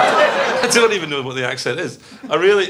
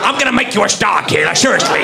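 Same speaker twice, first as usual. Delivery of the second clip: 1930s Hollywood lawyer. Acted. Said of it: "Hollywood lawyer from the nineteen thirties".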